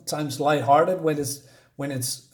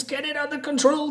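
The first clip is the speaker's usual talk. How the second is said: screaming order; 11 semitones higher than usual. Acted. Villain screams at the pilot, high-pitched panicked yell. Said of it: "And he screams".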